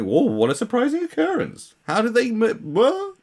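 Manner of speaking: high, hammed-up gasp of amazement; mock surprise. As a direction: fake shock at something obvious. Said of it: "I was like".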